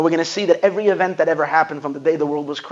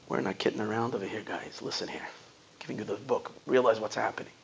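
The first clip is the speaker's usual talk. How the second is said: hushed earnest quote; 6 semitones lower, 13 dB quieter than usual. Retold or imitated. The Rebbe telling his followers to listen, hushed and earnest. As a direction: drop to a near-hush, urgent and serious.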